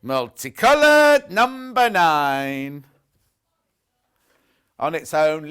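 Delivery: slow, drawn-out loud bingo call; bingo caller. Shown as a demonstration. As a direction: stretch it like a bingo hall caller. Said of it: "it sound like a bingo caller".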